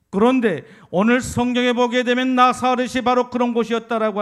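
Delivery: loud, rising sermon climax; fiery preaching. Acted. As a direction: preach it out full force to the congregation. Preaching again at force.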